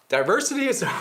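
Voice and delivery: cracking up mid-phrase; can't get the words out for laughing. Real, not acted. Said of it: "How do you say that without laughing".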